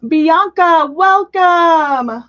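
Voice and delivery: loud, high, stretched-out greeting; big welcome. Real, not acted. Streamer welcomes a viewer joining, big excited greeting. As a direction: call out a big slow welcome.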